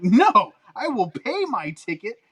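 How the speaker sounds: high, loud, laughing mock insistence on paying; mock indignant. Retelling how he refused the free entry to the staff, hammed-up and indignant. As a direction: play it up as proudly insisting on paying the full price. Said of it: "I'm like".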